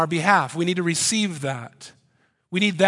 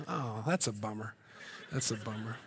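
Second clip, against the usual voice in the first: mock disappointed mumble; 5 semitones lower, 13 dB quieter than usual. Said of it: "a big squeaky voice".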